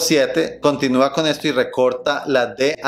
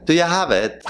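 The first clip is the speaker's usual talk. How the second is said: higher-pitched British take on the question; British accent; 6 semitones higher than usual. Shown as a demonstration. Teacher showing a variant, British accent. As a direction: make "Do you have it?" sound British. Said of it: "Eso es como más británico".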